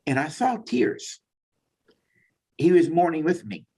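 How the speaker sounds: fighting back real tears; choked up. Real, not acted. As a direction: near tears, reliving God's compassion. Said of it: "tearing up, buddy, so hang on".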